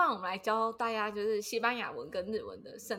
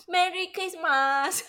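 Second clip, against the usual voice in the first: put-on Japanese-accented English; 8 semitones higher, 4 dB louder, much slower than usual. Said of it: "我们两个念起来也很gay bye".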